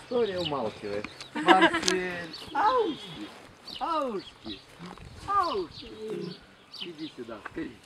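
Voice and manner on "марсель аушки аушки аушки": high sing-song call. Real, not acted. Calling the lion by name.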